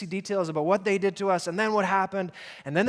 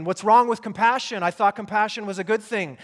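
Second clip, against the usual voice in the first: higher, raised-voice protest; acted, higher-pitched challenge; mild mock objection; 4 semitones higher, 5 dB louder than usual. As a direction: object as a puzzled listener would. Voicing the congregation's objection.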